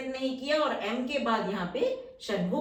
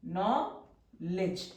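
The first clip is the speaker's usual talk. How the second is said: drawn out slowly; 4 semitones lower, 4 dB quieter, much slower than usual. Shown as a demonstration. Teacher writing the outline on the board, slowly drawn out. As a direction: say knowledge very slowly, each sound stretched. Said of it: "knowledge simple".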